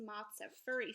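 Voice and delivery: ordinary talk, nothing marked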